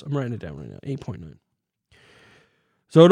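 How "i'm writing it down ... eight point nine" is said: muttering to self. Real, not acted. Talking while writing it down.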